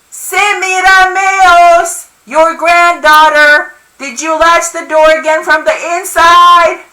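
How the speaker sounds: high, loud, slow drawn-out shout; top-of-lungs yelling. A narrator's reading. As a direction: bellow each word for deaf ears. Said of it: "I'm yelling at the top of my lungs".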